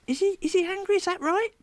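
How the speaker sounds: very high, gentle questioning; high sing-song concern; talking to puppet. Retold or imitated. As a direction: ask a puppet in a high, childlike voice. Asking the hand about the child.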